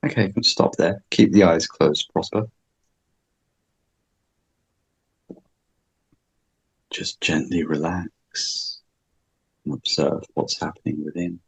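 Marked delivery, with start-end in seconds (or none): 1.7-11.4 soft slow calming guide; guided meditation. Acted. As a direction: ease them into relaxing. Guides relaxation.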